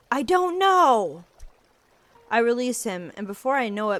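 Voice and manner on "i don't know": high, loud, slow shout; boy snapping at his condescending friend; angry snap. Acted. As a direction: snap it back at him. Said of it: "I snap at the condescending tilt".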